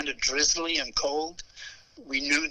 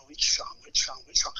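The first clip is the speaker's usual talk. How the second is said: faking cool. Retold or imitated. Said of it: "So I'm trying to be cool".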